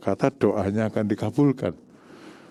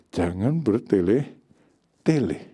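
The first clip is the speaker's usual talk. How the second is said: ordinary talk, nothing marked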